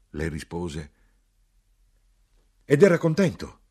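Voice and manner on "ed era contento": higher, louder challenge; raised voice, pitched well up; shouted question. A narrator's reading. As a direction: cry it out as a pointed question.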